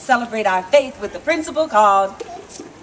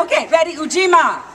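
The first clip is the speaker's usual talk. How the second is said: plain reading voice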